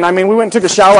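usual speaking voice

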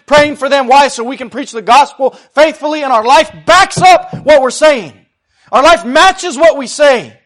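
loud, high-pitched pulpit shouting; full-force preaching. Acted. Preacher calling the church to intercede and preach, full-force preaching. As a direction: drive each phrase home at the top of your voice.

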